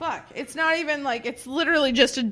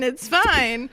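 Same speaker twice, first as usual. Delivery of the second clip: ordinary delivery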